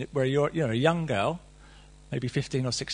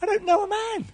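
very high put-on girl's voice; young girl's voice repeating it to Gabe; mock high Mary. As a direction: squeak it like a young girl. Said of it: "You know what I mean, Gabe?".

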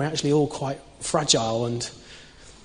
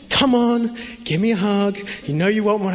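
relentless coaxing mum; 5 semitones higher than usual. Retold or imitated. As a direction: tease him into a hug, pitched up.

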